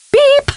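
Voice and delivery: short, very high machine beep; imitated beep. Professor ending a fake phone message, high beep. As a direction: mimic the closing tone of an answering machine.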